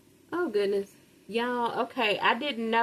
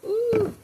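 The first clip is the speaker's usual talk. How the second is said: high, louder ooh of delight; 14 semitones higher, 5 dB louder than usual. Real, not acted. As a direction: let out a pleased high ooh at the taste. Showing off the finished plate.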